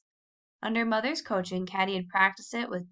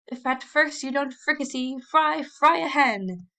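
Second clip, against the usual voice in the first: higher, louder, slow hammed-up declamation; mock dramatic declaiming; 6 semitones higher, 5 dB louder than usual. A narrator's reading. Tom teasing Warren with his version, hammed-up declaiming. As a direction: ham it up like a pompous orator. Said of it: "a dramatic attitude and declaimed".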